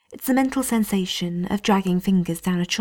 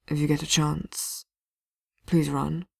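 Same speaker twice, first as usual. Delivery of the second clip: lightly voiced wounded warrior, softer; hint of a hurting man; 3 semitones lower, 4 dB quieter than usual. Wounded Blackwall urging Vivian to flee, softly pained. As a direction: push through the pain to urge her.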